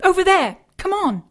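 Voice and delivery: urgent shout to follow; calling out. Acted. Elizabeth calling Harry to chase the figure, shouting. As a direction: call him on as you run.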